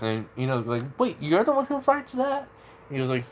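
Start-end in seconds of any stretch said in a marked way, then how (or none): 1.0-2.5 put-on much higher, girlish voice, caught off guard; surprised Ino. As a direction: squeak it out in disbelief as the girl. Ino asks, shocked.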